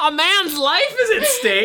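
slow, high-pitched, incredulous plea; hammed-up alarm; comic outrage. Real, not acted. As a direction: stretch each word in laughing indignation. Comic outrage over the plot.

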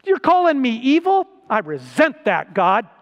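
a preacher playfully resenting being called evil; mock offended. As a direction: protest to God, hammed-up offended. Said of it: "I'm thinking to myself".

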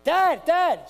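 high, loud call of a hurt son; imitated scream. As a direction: scream out for Dad like a hurt child. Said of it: "screams out".

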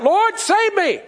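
high, loud cry for help; loud, high plea from a sinking man. Acted. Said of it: "He cried out".

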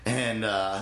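usual speaking voice

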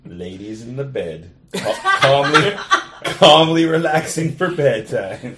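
slow, hammed-up sultry relaxing voice; mock seductive, slow and drawn out; sultry bedtime voice. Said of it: "Sultry, half-lidded, relaxed state".

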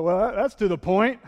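half-joking protest; mock objection. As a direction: needle him like a skeptic. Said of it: "I'm like".

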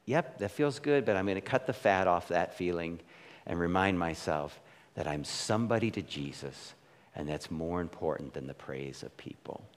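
quietly talking himself down; inner voice. Retold or imitated. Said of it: "and now I'm like".

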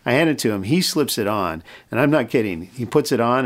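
usual speaking voice

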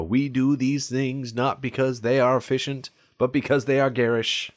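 mock-grand JFK speech voice, in a Boston accent; hammy JFK Boston accent delivering a lofty speech; JFK impression. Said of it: "I can imagine a J F K inspired Immortan Joe".

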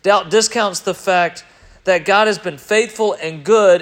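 ordinary talk, nothing marked

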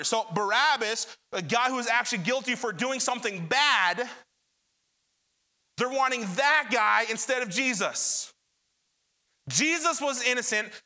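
ordinary talk, nothing marked

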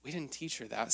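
hushed aside. Retold or imitated. Thought said to wife.

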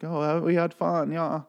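high-pitched put-on girly voice; mock girls' voice. Voicing the Swedish girls leaving happily.

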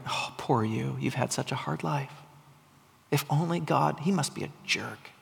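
soft, low, insinuating voice; whispering enemies. Acted. Enemies whispering to the weary believer, low and soft. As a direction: murmur doubts like a tempter. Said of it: "And our enemies are there".

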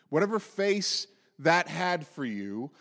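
ordinary talk, nothing marked

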